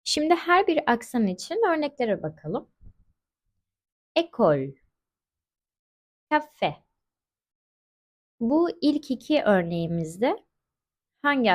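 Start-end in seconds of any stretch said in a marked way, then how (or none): 4.2-6.8 "Ekol" and "kafe" with a closed French é. Shown as a demonstration. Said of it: "Kapalı E okuduk". ("Ekol" ve "kafe" kapalı E ile)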